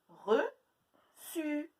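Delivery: reçu slowly, stretching the final u; drawn-out word. Shown as a demonstration. Teacher to pupils, stretching the final u. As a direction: stretch the u at the end. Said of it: "Le U, il est cette fois à la fin".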